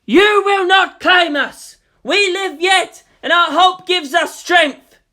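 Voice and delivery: very loud, slow, high defiant shout. A narrator's reading. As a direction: bellow it to the sky. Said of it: "shouted to the sky".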